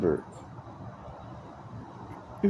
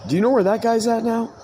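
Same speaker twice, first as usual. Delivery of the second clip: loud call; 12 semitones higher, 6 dB louder than usual. Real, not acted. Sudden loud question to someone.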